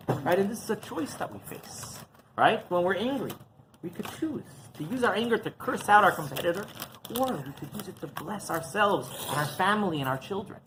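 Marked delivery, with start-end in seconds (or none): none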